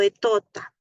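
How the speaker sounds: "etota" for "pelota", the p swapped out and the l made a t; child's mispronunciation. Shown as a demonstration. Therapist giving another toddler version of pelota, "etota". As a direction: say "etota" the way a toddler garbles the word.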